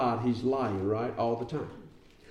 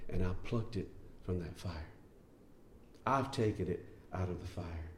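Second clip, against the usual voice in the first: soft voice of God; 4 semitones lower, 9 dB quieter than usual. Acted. Preacher voicing God, soft.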